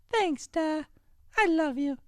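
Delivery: put-on very high girlish voice; daughter voice. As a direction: speak as the sweet daughter.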